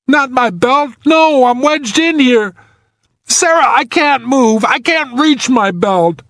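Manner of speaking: high, strained, frightened protest; scared. A narrator's reading. Wedged man tells rescuer he can't move, scared. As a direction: plead helplessly, voice pitched high. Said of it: "No wonder he was scared".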